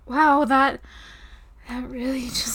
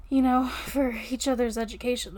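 ordinary delivery

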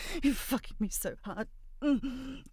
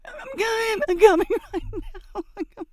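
high, loud, breathless peak; acted climax cry; 5 dB louder than usual. She cries out to her partner, high and loud. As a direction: let it break out at the top of the voice.

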